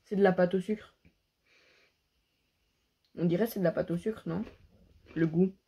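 snack at lips; talking while chewing. Real, not acted. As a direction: mouth partly full. Seen 2: Snack held at lips, mouth appears to chew throughout.

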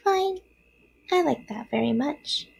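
high opening, small spider voice; little spider. A narrator's reading. Third spider accepts Wilbur's suggestion, small voice. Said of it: "said the third spider".

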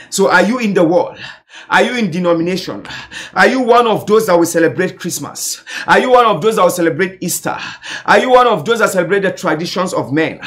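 insistent, rhythmic exhortation; preaching. Real, not acted. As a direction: press the congregation with phrase after forceful phrase. Preaches at full force.

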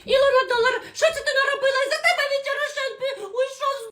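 put-on wailing old woman. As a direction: blame the wife for the son leaving. Mother-in-law blames the wife. (висока, плаксива стара свекруха)

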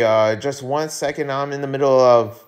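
ordinary talk

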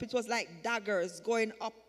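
ordinary delivery

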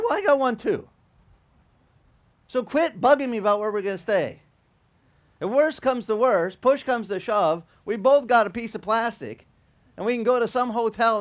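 mock-exasperated son waving his credit card; exasperated retort. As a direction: snap back to end the nagging. Son tells off nagging dad.

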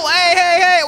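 loud high protesting shout. Real, not acted. Game mishap, shouting.

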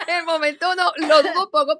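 high, loud words through laughter; laughing. Real, not acted. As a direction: talk while still laughing. Laughs while recalling doubt. (hablando entre risas, más alto)

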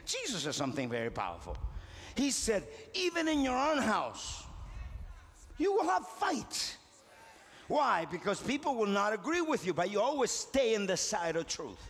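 ordinary talk, nothing marked

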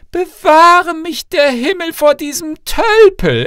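high, loud, slow indignation; haughty princess recoiling, high and loud; scornful outrage. Acted. Princess scornfully rejects suitor.

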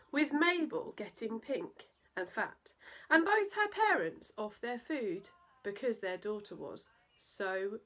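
put-on lively rhyming read-aloud for children; playful children's story narration; storytelling voice. A narrator's reading. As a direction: bounce through the verse like a bedtime poem. Reads a silly poem to children.

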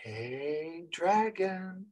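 hushed, timid greeting to a dragon; quiet. Shown as a demonstration. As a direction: say "Hey, dragon" softly, almost to yourself.

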